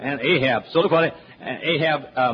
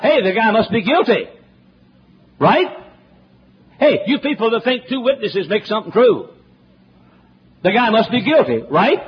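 sarcastic challenge; 6 semitones higher, 4 dB louder than usual. Real, not acted. Mocking the false witnesses.

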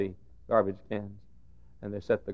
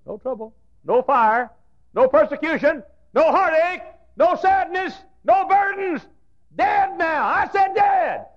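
high, loud hammering list; preaching at full force; 9 dB louder than usual. Acted. Preacher condemning easy churches, loud high climax. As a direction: pound each line higher and louder.

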